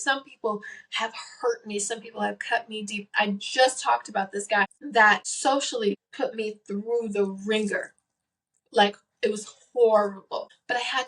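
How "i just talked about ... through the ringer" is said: normal talking voice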